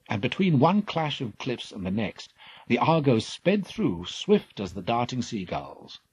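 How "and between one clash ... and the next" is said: plain reading voice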